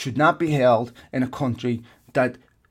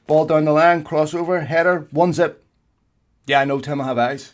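ordinary talk, nothing marked

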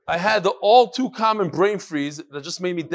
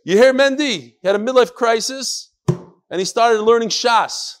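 raised voice, higher and louder; calling out; 6 semitones higher, 7 dB louder than usual. Real, not acted. Reader repeats the email for Mendy, calling out. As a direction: call it across to an inattentive listener.

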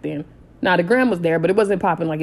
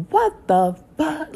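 much higher, slow outraged yell; going off; 9 semitones higher, much slower than usual. Retold or imitated. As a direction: blow up at him. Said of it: "I'm going off".

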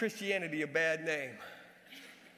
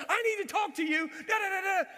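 high-pitched shout trailing into babble; reenacted yelling; 13 semitones higher, 6 dB louder than usual. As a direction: shout it after him, frantic and high. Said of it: "I'm yelling at the top of my lungs".

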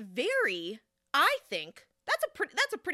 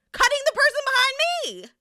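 much higher, louder indignant burst; outraged disbelief; 10 dB louder, faster than usual. Real, not acted. Narrator outraged at the woman cutting in, high and loud. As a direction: let the outrage spill out.

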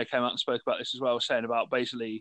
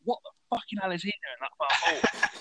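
higher, hammed-up furious rant at the player; mock angry manager; 7 semitones higher than usual. Manager angrily pointing at his player for being too far forward. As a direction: play the livid manager shouting at his assistant. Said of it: "He genuinely looks angry".

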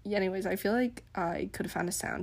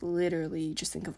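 ordinary talk

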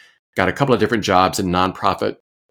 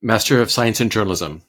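normal talking voice

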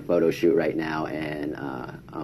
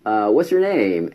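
high, bright hey then a slower question; perky hello to a stranger; friendly greeting; 8 semitones higher, slower than usual. Retold or imitated. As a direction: greet him cheerfully. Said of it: "I'm like".

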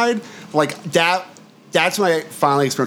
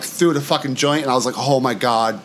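usual speaking voice

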